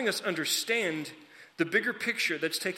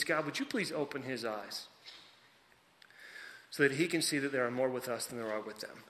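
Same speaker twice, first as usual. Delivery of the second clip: much softer, hushed plea to God; quiet prayer; 8 semitones lower, 9 dB quieter than usual. Acted. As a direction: pray it low and trusting. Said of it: "He prays".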